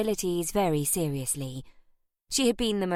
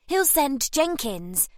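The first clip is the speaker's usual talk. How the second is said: put-on eager boy; 8 semitones higher, 3 dB louder, slower than usual. A narrator's reading.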